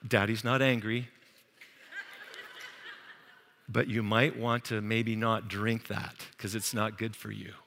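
low, soft, hesitant daddy voice; exaggeratedly calm, halting and quiet; mock mild-mannered parent. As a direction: gently tiptoe through a warning to a child. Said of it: "we wouldn't talk like that".

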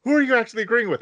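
high-pitched mock quarrel; exasperated demand. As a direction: act out an indignant challenge in a cartoon squabble. Countries arguing in retold anime scene.

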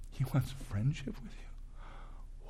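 slow, intimate whisper. Real, not acted. Preacher tells listeners God wants friendship, slow whisper. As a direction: lean in and whisper it like a secret.

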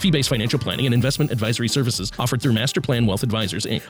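rapid-fire legal disclaimer read; fast legal disclaimer. Acted. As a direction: rattle off the fine print fast. Fast disclaimer read over music.

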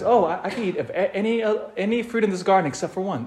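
hurried, a hint of a flustered Eve; Eve replying. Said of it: "And then Eve says".

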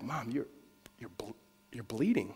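soft, faltering words that restart; hushed, halting shock. Retold or imitated. Son telling his mom she is hurt, hushed and halting. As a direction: break off, then say it quietly. Son notices mother's head wound.

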